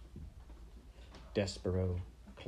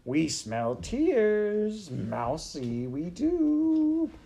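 very high, loud, slow sing-song; taunting rat shout; 12 semitones higher, 6 dB louder, much slower than usual. A narrator's reading. Rats taunting the crying mouse, high shouting. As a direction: screech it as a jeering chant. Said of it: "shouted the rats".

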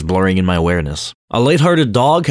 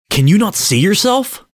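sharply higher, horrified question; horrified, disgusted; 12 semitones higher than usual. A narrator's reading. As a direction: recoil in horror and disgust at yourself. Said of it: "horrified and disgusted".

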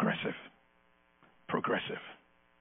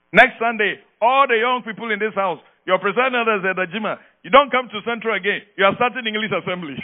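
light imitation of the Apostle's accent, a bit higher; imitating the Apostle's accent; 5 semitones higher than usual. Preacher imitates the Apostle announcing in his accent. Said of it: "in that wonderful accent too".

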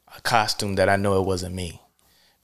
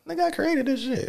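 much higher, softer mock inner voice; inner voice quote; 5 dB quieter than usual. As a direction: squeak out the private thought, half-joking. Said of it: "I'd be like".

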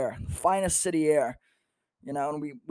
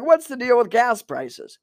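high, loud, slow joke setup; hack stand-up delivery; 8 semitones higher, 6 dB louder, slower than usual. Acted. Distracted comic doing his set, hack stand-up delivery. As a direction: belt out a stock observational opener. Parodies a stock stand-up bit.

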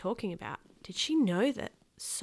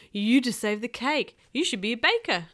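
put-on loud, higher, thrilled kid; excited praise; 4 semitones higher, 8 dB louder than usual. Kenny praises his brother saving the cake, loud excitement. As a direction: marvel at it loudly.

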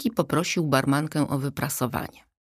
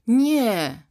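mild, higher-pitched moan; 7 semitones higher, 4 dB louder than usual. A narrator's reading. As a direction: moan it in dismay. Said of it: "jęknęła Zosia".

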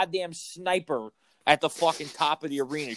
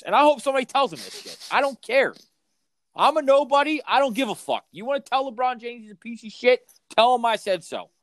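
heated rant; 7 semitones higher than usual. Real, not acted. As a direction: shout it out like you're done holding back. Rant against LeBron.

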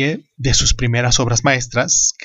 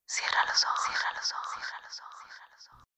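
right in your ear; 18 dB quieter, much faster than usual. Acted. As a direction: whisper fading to nothing. Whispered intro fading out.

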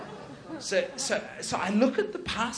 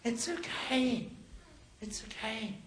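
soft, slow soothing; gently calm someone who is crying; hushed reassurance, trailing softer; retold consoling; 9 dB quieter, slower than usual. Consoling the wailing man.

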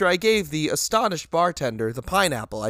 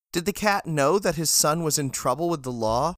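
plain reading voice

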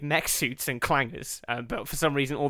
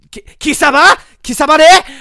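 high, loud shouted anime protagonist yell; a full-throated Japanese anime war cry; anime hero battle cry; 14 semitones higher, 17 dB louder than usual. Acted. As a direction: scream it like a dubbed anime hero charging in. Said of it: "I don't know why he speaks Japanese".

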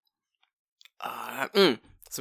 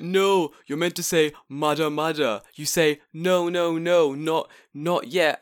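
know-it-all correction; 3 semitones higher, 6 dB louder, slower than usual. Retold or imitated. Stranger lecturing him on humility.

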